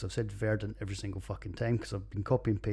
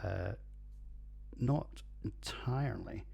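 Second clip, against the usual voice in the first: ordinary talk, nothing marked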